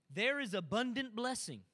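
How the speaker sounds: ordinary delivery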